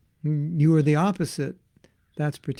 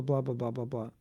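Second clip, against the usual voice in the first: normal talking voice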